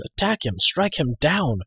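high, rousing battle cry; eager, impetuous exhortation; bold declaration. A narrator's reading. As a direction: urge a fight with hot-headed zeal. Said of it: "Taran declared".

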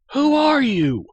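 high, loud shouted demand; startled shout. A narrator's reading. Frightened prisoner to a shadow rising from the ground, shouting. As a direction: shout it in alarm, pitch leaping up. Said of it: "Taryn shouted".

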